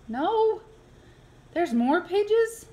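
high, stretched incredulous question; dismayed disbelief. Acted. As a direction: plead in disbelief.

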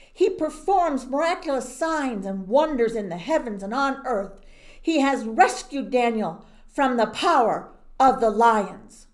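loud, grand proclamation of a king; king proclaiming God to all his people; royal decree. Acted. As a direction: declare it to the whole kingdom.

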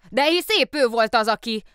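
high, loud, hurried excuses; the policeman desperately excusing himself to the mayor; flustered policeman. Acted. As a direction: stammer out an apology in a rush. Policeman's last attempt.

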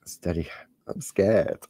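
ordinary talk

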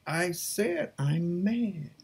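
ordinary talk

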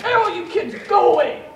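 loud, hammed-up dismissal; exaggerated fed-up parent; mock exasperation. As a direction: shoo them off with a yell. Imitates fed-up father dismissing children.

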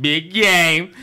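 "Big Game" called out high and teasing; mock taunting call. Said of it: "he hated when you like".

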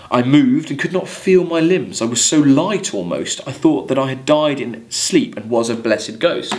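measured verse reading to the class; poem reading. Acted. Teacher reading Coleridge verse to students, measured poem reading. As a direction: read it like a poem, letting lines ring.

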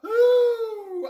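high, loud squeal of glee; excited squeal. Real, not acted. Excited squeal before tasting.

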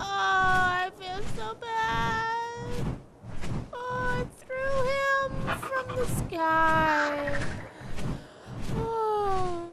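high, slow, half-sobbing remorse; crying guilt. Real, not acted. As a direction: wail with real guilt. Guilt after dropping pet.

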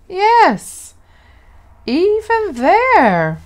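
high, loud, slow and sure; mother promising with big lifted voice; emphatic reassurance. A narrator's reading. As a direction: lift it high and hold it with conviction.

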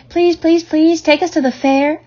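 high pleading gosling voice, every please pushed up; begging gosling. A narrator's reading. One gosling begging its mother for the fair, high eager pleading. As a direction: beg like a small bird desperate to go. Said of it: "begged a gosling".

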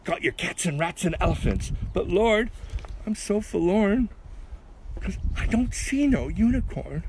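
high-pitched call up to the Lord; Noah calling out. Acted. Noah reporting the animals to the Lord, high calling out. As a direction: call out the verse, pitched well up. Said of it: "he called out as they went through".

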